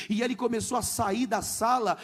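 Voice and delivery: usual speaking voice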